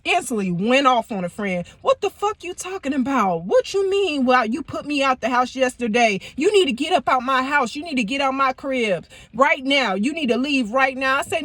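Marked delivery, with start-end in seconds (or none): 1.8-11.2 angry outburst. Retold or imitated. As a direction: go off on her, furious. Boyfriend going off on friend.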